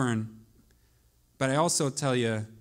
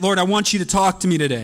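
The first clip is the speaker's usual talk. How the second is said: louder, earnest plea to the Lord; say it like you mean it; 6 semitones higher, 7 dB louder than usual. Shown as a demonstration. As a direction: call out to God with conviction. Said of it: "Say it like you mean it".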